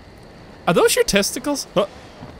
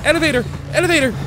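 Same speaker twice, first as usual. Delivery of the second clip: repeated words climbing higher; panicked urgency; 5 semitones higher, much faster than usual. Real, not acted. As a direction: chant it anxiously while escaping. Fleeing toward the elevator.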